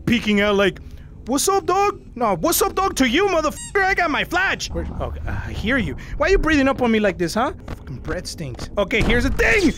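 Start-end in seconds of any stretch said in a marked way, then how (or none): none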